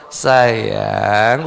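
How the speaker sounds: soft, low sweet-talk to a sulking wife; coaxing husband. Retold or imitated. (bujukan lembut suami)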